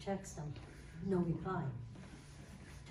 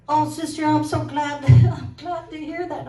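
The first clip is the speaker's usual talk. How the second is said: high, loud gushing friend voice; excited friend; 8 semitones higher, 11 dB louder than usual. Retold or imitated. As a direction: gush like an overjoyed friend.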